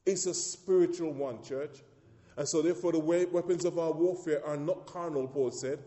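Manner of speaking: loud, ringing preaching to the church; full-force preaching. Acted. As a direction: preach it out, hammering each phrase.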